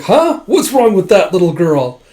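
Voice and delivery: loud, high incredulous question; retold baffled outburst; acted bafflement. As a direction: start on a high "huh" of disbelief.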